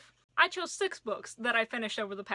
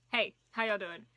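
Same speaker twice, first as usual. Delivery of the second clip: normal talking voice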